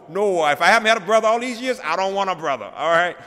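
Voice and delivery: indignant inner rant about a secret brother; retold anger. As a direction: rant as if betrayed, half-joking. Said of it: "my grief turned to anger".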